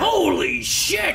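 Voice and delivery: long drawn-out high holler; slow, high shout of astonishment; surprised remark. Acted. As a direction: stretch it into a loud, amazed cry. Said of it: "surprise remarks".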